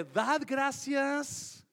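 "Dad gracias" drawn out slowly; slow emphasis. Shown as a demonstration. Pastor quoting scripture to church, slow emphasis. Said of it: "reads the verse slowly".

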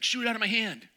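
high, loud eager dare. Retold or imitated.

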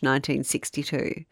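ordinary talk

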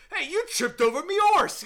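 high, loud Cockney shout from the background; yelled Cockney accent. Retold or imitated. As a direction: bellow it like a London street vendor. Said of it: "Everyone just do an accent and yell".

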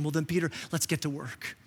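soft, reassuring Jesus; hushed, kind call to work; gentle invitation. Acted. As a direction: invite him back quietly. Jesus inviting Peter back.

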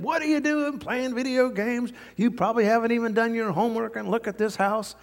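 high, rapid-fire acted scolding; laying into someone. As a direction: lay into a child, hammed-up. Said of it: "she just lays into him".

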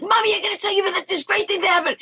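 put-on high and fast, a child bursting with news; fast excited child. Little girl telling her mom about her day, high and fast. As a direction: gush to mommy in a rush. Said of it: "I'd be like".

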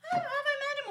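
very high, mock-confused dad voice; baffled dad. Dad asking his daughter about the fiancé, baffled. Dad's baffled question, acted.

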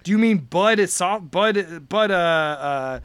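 usual speaking voice

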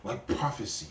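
low, hushed mock sage voice; mock mystical sage, low and quiet; fortune-cookie wise master. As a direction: intone it like a movie martial arts master. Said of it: "speak like this with this".

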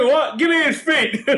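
high, indignant snap with a laugh; mock outrage. Masseuse reacting to the guy's age guess, mock outrage. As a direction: play the insulted masseuse, half laughing.

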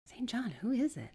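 high, lightly voiced woman; curious sister. Sister asking her brother about the stranger, curious.

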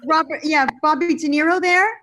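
loud, high eager caller asking for De Niro; excited caller. Retold or imitated. [name] mimics the caller asking for De Niro, loud and high. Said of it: "he said".

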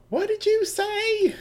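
high, sweet, syrupy Southern belle drawl. Acted. GM voicing Mitsuba to Yasuho as a sweet Southern belle. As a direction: play the genteel Southern lady, all honey. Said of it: "doing her Southern Belle".

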